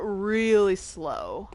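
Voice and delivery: very slow, stretched words; showing how slowly Maine people talk, very slowly; slow drawl. Shown as a demonstration. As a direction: draw every word out. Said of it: "everything is said very slowly".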